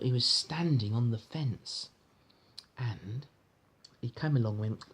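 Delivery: usual speaking voice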